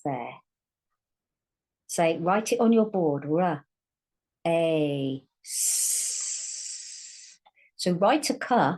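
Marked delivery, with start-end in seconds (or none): 5.4-7.4 voiceless "S", just air; s sound. Shown as a demonstration. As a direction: hiss a long "S" as in sun, no voice. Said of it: "say the S sound".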